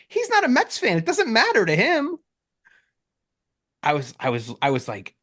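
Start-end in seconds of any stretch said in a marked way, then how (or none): 0.1-2.2 much higher, fast irritated comeback; exasperated retort. Retold or imitated. To his sister blaming her brother-in-law, high exasperated retort. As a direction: shoot down her excuse.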